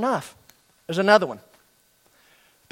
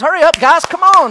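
loud, high coach call; yelling at late students; 9 semitones higher, 8 dB louder than usual. Retold or imitated. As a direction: hurry the boys along from across the field. Coach calling to students outside.

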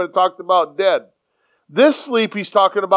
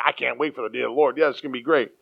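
quick, soft, lower mock eagerness; eager people; 7 semitones lower, 5 dB quieter, faster than usual. Preacher mimicking complacent people longing for the Lord's day, quick and soft. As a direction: rattle it off like a careless churchgoer. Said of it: "the people are expecting".

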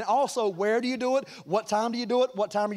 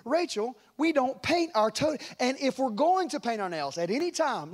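firm, raised fatherly lecture; correcting daddy; 6 semitones higher than usual. Retold or imitated. Dad correcting his daughter about painting nails, firm. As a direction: spell out the rule for a child. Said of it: "when I was sort of correcting some things".

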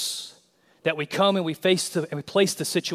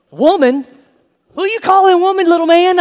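a much higher, loud, sassy mama; sassy mother; 13 semitones higher, 11 dB louder than usual. Acted. [name], as a sassy mama, putting her son in his place. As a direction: mom offended, cutting him down.

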